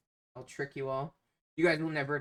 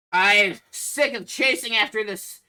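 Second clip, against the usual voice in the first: loud, higher angry ogre outburst; fed up, bellow it at the party; pissed-off ogre; 6 semitones higher, 10 dB louder than usual. Acted. Ogre fed up with chasing the bolting kid, pissed. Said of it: "pissed as he, um, sees the kid".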